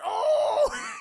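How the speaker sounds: high, loud startled oh; startled yelp. Retold or imitated. Girls caught by the teacher, loud startled yelp. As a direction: jump at being caught.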